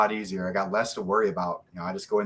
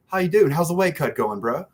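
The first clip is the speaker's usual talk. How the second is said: imitated Australian-British accent, higher and louder; chummy Aussie-Brit greeting, pitched up; Mark Godbeer; 6 semitones higher, 4 dB louder than usual. Fighter mimicking an accented acquaintance checking on his weight cut, Australian-British accent. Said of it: "has the most beautiful Australian British accent".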